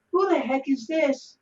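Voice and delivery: mock bewildered who's-at-the-door; puzzled surprise. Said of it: "And I'm like".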